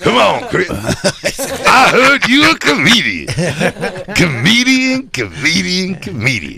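high, slow, hammed-up comedian voice; mock star scoffing in a stretched, high voice; Chris Rock. Playing a star brushing off a comic, Chris Rock impression. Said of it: "Let's do Chris Rock".

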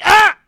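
loud, high mock cry of pain; anguished yell. Imitating heartbroken Braves fans with a mock anguished yell. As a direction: wail like a heartbroken fan. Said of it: "and they're like".

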